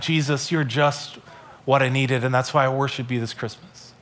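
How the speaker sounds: humble, hushed worship; grateful prayer. Acted. Voicing a grateful prayer to Jesus.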